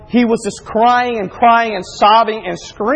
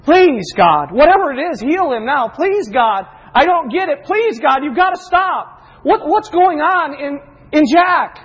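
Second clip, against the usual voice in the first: high, urgent pleading, re-enacted; impassioned retold plea, pitch climbing; desperate prayer; 7 semitones higher, 3 dB louder than usual. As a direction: cry out to God as a helpless father. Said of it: "I start praying out to God".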